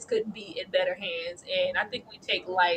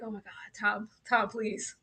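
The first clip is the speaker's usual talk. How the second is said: much softer, pleading under the breath; hushed plea; 8 dB quieter than usual. Retold or imitated. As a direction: beg Tom in a tense hush. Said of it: "I was like".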